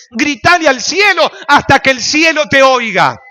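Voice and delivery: strong loud exhortation; loud preaching. Real, not acted. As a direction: call it up toward heaven. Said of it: "Grita más fuerte".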